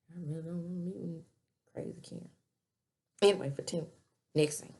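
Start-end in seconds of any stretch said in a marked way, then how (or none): 0.1-2.3 quiet, trailing off into a hush; soft muttered aside. Retold or imitated. Sabrina dreading meeting Karen, said softly. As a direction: mutter it under your breath.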